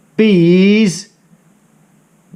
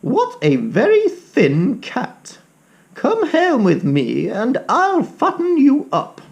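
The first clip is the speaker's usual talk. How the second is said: put-on kindly old lady; 9 semitones higher than usual. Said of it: "said the old lady".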